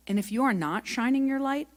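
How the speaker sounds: ordinary talk, nothing marked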